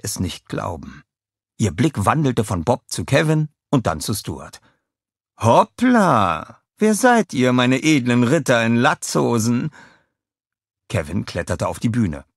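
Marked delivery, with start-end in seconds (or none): 5.4-9.7 high, loud, charmed villainess; a high, delighted greeting; Scarlett's delight. A narrator's reading. Scarlett greets the minions, high and delighted.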